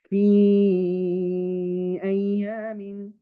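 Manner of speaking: slow recitation, the ي of في held long before أيام; mad munfasil recitation. Shown as a demonstration. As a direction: stretch في slowly into أيام. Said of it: "That is your mad munfasil".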